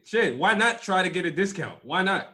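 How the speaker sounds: ordinary talk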